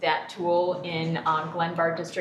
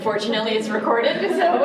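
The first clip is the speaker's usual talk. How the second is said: louder, higher, breaking into a laugh; 4 semitones higher, 8 dB louder than usual. Real, not acted. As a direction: say it through a real laugh. Joking about the meeting being recorded.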